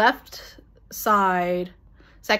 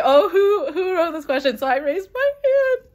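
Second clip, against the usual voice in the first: much higher, thrilled voice; excited retelling; 9 semitones higher than usual. Real, not acted. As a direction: tell it like you're still bursting. Retells question about who wrote it.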